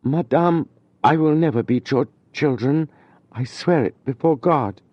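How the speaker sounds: holding himself back while swearing; constrained voice. A narrator's reading. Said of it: "in a constrained voice".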